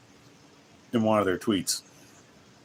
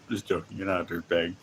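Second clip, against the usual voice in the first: normal talking voice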